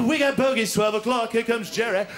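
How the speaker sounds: lightly voiced wartime airman; bomber crew radio call. Airman warning crew of bogeys, hint of urgent radio call.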